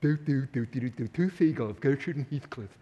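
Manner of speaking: a light hummed clown routine, lightly voiced; Red Skelton bit. Preacher mocking aimless living, lightly imitating a comedian's gull bit. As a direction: hum the doodling intro then name the gulls like a comic. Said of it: "like Red Skelton".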